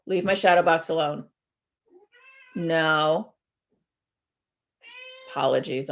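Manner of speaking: ordinary talk, nothing marked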